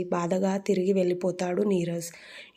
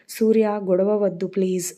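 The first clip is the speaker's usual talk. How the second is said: ordinary delivery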